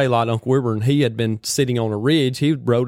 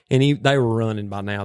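ordinary delivery

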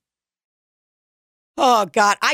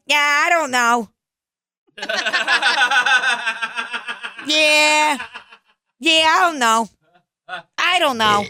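put-on East Coast accent; 3 semitones higher than usual. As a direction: imitate a Long Island or Buffalo shrug, cracking up. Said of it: "the way they're like".

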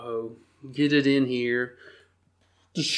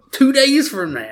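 mock disbelief; 11 semitones higher, 6 dB louder than usual. Quoting his frantic search.

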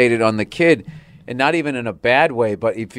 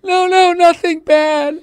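high, loud, slow mock wail; hammed-up blubbering, dragging out the words; hysterical crying, imitated; 6 dB louder, slower than usual. Wife explains she just saw a film, mock hysterical sobbing. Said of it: "she was crying hysterically".

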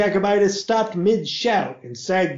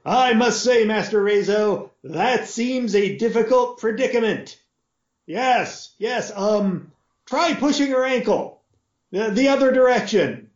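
a lightly raised, polite butler voice; butler encouraging; 8 semitones higher than usual. Acted. Butler awkwardly encouraging the pinned boy, lightly higher.

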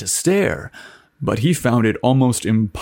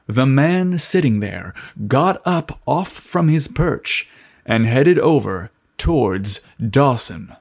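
ordinary delivery, nothing marked

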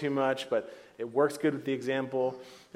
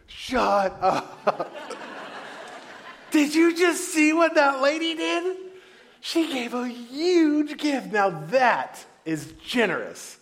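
much higher, louder, laughing disbelief; amazed laughing exclaim; 11 semitones higher, 5 dB louder, slower than usual. Retold or imitated. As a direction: grab his friend's arm, delighted. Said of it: "Jesus hits him and goes".

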